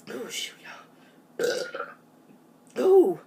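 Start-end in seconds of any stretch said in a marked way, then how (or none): 0.1-0.7 whisper. Real, not acted. Aside after burping.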